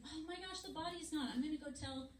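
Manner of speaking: high, hushed gasp of discovery; acted alarm. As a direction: voice Mary's shock, high and soft. Mary finds the empty tomb.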